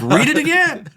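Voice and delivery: mock insistent husband telling wife; insistent retort. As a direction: push back firmly, half-joking. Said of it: "I'm like".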